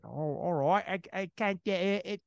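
stuttering attempt at British; failed British accent. Gary Oldman losing his British accent, halting British attempt. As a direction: stumble over a British accent and give up. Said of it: "to have a British accent".

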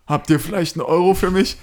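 high, put-on begging voice; imitated begging drunk. Homeless man asks helpers for money, high imitated voice. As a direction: beg as the old street man. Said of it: "Und meint so".

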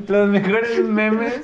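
slow words through lingering laughter; after laughing hard. Real, not acted. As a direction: still catching breath from laughing. Still laughing after big laugh.

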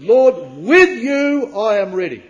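loud, slow, overconfident boast; cocksure, ringing declaration; standing high. Acted. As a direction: proclaim it boldly, high and hard on "with you". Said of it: "not in the attitude ... of standing high".

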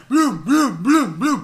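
loud, rhythmic "blum" thuds; imitated running thuds. Gordito showing how he runs, pounding mock thuds. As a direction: mimic heavy running in pounding beats.

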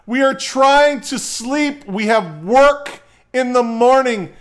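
high, loud, slow scolding; angry yelling. Retold or imitated. Wife scolding the noisy neighbor at two a.m., high and loud. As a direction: lay into him, word by word.

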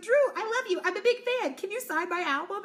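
high-pitched gushing fangirl; starstruck fan. Retold or imitated. [name] asking her idol [name] to sign an album, high and gushing. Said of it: "she's like".

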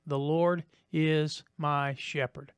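slow, deliberate recitation; a morning prayer said slowly at the bedside. Shown as a demonstration. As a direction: say it slowly, savoring each word. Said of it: "and you say".

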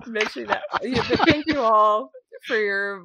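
teary laugh while thanking; real tearful laugh; laughing through tears. Real, not acted. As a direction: laugh it out, half crying.

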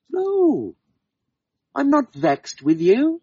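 high, slow fairy woman voice; a light fairy woman, slowed down; fairy woman denying. Acted. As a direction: gently deny it, drawing it out. Woman denies being vexed.

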